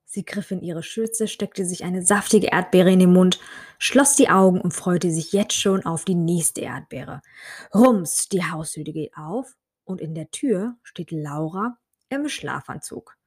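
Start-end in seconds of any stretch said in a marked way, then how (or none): none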